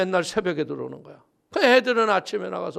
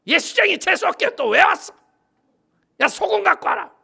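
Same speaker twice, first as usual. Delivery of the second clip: high, loud, sneering shout; hostile housewife; 10 semitones higher, 7 dB louder than usual. Retold or imitated. Woman driving the preacher away, angry sneering shout. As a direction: bark her away from the door.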